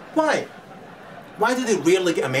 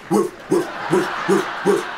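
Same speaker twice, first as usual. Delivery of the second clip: imitating barking dogs.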